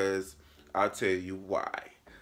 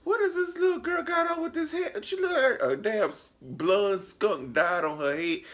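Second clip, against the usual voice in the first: mocking high voice; 3 dB louder than usual. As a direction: shriek it out in shocked disbelief. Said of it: "And I was just like".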